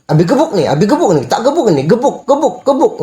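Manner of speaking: half-joking dad threatening a spanking; mock threat. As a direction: tease the kid with empty threats, building up. Father mock-threatening child.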